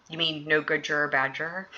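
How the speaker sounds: ordinary delivery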